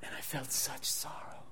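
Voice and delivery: hushed, grieving whisper; whispered remorse; sorrowful whisper. Real, not acted. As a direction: barely voice it, heavy with regret. Remembering hurting his wife.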